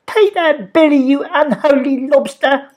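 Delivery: put-on high, loud girl cheering; triumphant. A narrator's reading. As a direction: gloat in triumph. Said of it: "shouted Polly in triumph".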